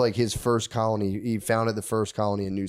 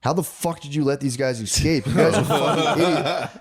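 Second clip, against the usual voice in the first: a hammed-up furious [name], higher and breaking into a laugh; mock outraged scolding; 4 semitones higher than usual. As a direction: chew them out in fake disbelief.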